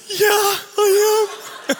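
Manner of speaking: gushing with joy; squealing yes; delighted. Retold or imitated. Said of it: "this volunteer goes".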